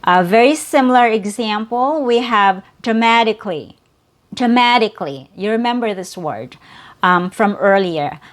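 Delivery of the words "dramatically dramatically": "dramatically" with the t as a quick D tap; "dramatically" with no vowel after the k; fast D pronunciation. Shown as a demonstration. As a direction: say "dramatically" in four syllables, stressed on "ma". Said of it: "this word has a fast D sound".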